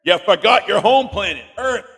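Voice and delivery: mock shock. Host mock-shocked at kids.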